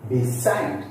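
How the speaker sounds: "beside" with SIDE strong; stress on second syllable. Shown as a demonstration. Teacher listing prepositions, second-syllable stress. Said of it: "we give stress on the second syllable".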